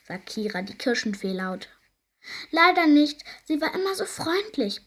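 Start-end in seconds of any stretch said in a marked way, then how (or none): 2.5-4.8 high, loud eager reply; eager agreement. A narrator's reading. Fairy plays along at market, high loud reply. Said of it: "bestätigt Bruni".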